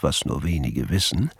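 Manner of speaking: hushed narrator's aside; aside narration. A narrator's reading. Erzähler tritt ins Hörspiel. (leiser Erzählerkommentar)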